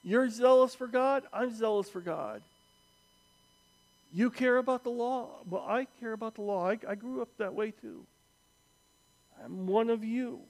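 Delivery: lightly voiced Paul, reasoning as one of them; earnest appeal as Paul. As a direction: win them over, gently insistent. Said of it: "Is Paul saying".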